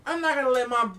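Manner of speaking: high, loud insistence; heated protest. Retold or imitated. Groom's side insisting on the prenup, in a high, loud protest. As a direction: lay down the law. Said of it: "They're like".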